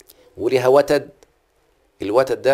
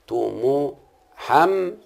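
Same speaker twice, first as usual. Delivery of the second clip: تو مو حم said very slowly, syllables pulled apart; syllable demo; 3 semitones higher, much slower than usual. Shown as a demonstration. Teacher splitting the word into syllables for students, very slow. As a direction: break it into slow separate beats. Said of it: "لازم الكلمة كلها تبقى".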